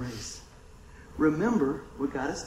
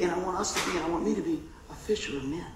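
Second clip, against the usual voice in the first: ordinary delivery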